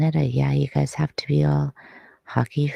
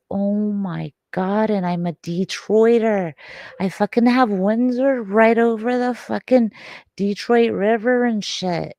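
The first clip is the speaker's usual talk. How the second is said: higher, worked-up self-reproach; exasperated outburst; 6 semitones higher, 4 dB louder than usual. Real, not acted. Detroiter kicking themself for forgetting a neighbouring team, exasperated outburst. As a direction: exclaim it higher, appalled at yourself.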